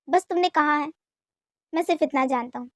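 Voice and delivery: usual speaking voice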